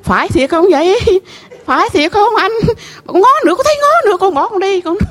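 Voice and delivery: high, excited retold shock; startled disbelief. Widow retells the grave portrait staring back, startled and high. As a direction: pitch climbing as if spooked.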